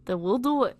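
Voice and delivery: put-on Scouse; bad Liverpudlian accent. Reader redoing Tom's line as British, bad Liverpudlian accent. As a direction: read it in a shaky attempt at Liverpool accent. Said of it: "my shit Liverpudlian accent".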